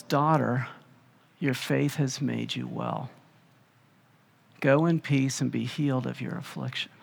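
soft, gentle blessing; Jesus gently blessing the healed woman; tender. Acted. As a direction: speak it like a father to his child. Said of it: "such a tender term".

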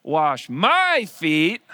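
very slow, loud "wash my feet"; emphatic. Retold or imitated. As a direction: drag out each word insisting. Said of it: "it's emphatic".

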